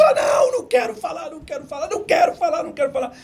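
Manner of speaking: imitated high-pitched crying wail; mock-sob a loud, panicky refusal; tearful refusal. Crying secretary refusing the phone call, hammed-up high wail. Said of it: "ela começava a chorar mais ainda".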